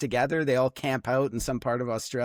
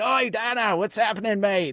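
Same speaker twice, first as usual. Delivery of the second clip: cheery Aussie greeting; 8 semitones higher than usual. Retold or imitated. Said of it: "And they're like".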